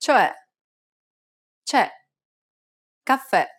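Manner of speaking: "Cioè, c'è, caffè" with wide, open final E; open Italian E. Shown as a demonstration. Teacher showing learners the grave accented E, open Italian E. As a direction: drop the jaw on the E of "Cioè, c'è, caffè", as in "red". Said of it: "First is the grave accented E".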